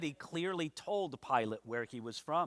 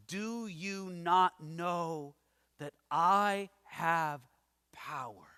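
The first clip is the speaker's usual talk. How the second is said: slow amazed question; 4 semitones lower, much slower than usual. Acted. Preacher voicing Pilate, amazed Jesus isn't intimidated, very slow and hushed. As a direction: let each word hang in disbelief.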